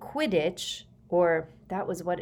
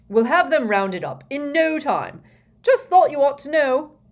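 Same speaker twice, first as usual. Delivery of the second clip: high, loud parting call; shouting; 8 semitones higher, 10 dB louder than usual. A narrator's reading. Fudge shouts to the Prime Minister while vanishing, hurried shout. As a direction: shout it back while rushing off.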